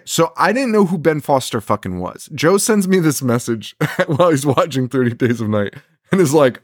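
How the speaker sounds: ordinary talk, nothing marked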